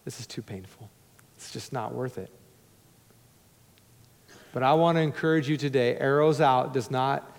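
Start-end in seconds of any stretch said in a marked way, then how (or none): 0.0-2.3 defeated mutter. Retold or imitated. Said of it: "And just say".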